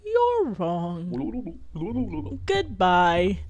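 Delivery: low, lightly mimicked dismissal; imitated quote.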